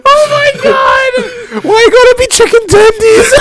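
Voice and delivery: high, wheezing with laughter; real laughing fit, high; laughing hard. Real, not acted. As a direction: choke it out cracking up. Cracking up at partner's joke.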